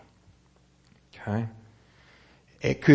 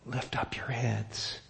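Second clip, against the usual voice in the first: soft slow repeat; 9 dB quieter, slower than usual. Shown as a demonstration. Repeats the command for emphasis.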